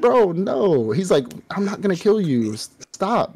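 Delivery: high, insistent pleading denial; high exasperated protest. Retold or imitated. Demon telling the girl to stop, high and exasperated.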